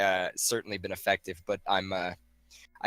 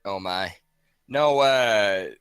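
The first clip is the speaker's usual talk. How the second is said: ordinary talk, nothing marked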